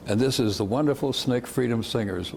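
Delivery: ordinary talk, nothing marked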